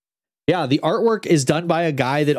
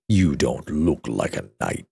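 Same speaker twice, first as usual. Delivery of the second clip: very low, slow dragon drawl; looming over a tiny owl; deep dragon voice; 12 semitones lower, 3 dB quieter, slower than usual. A narrator's reading. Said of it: "said the dragon".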